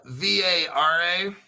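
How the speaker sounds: ordinary delivery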